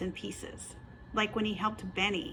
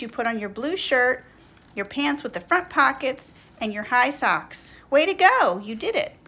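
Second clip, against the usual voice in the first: loud, cheering storybook mom; exclaiming praise; 5 semitones higher, 12 dB louder than usual. A narrator's reading. Mom praising Schubert's dressing, exclaiming. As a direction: cheer on each achievement. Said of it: "she exclaims".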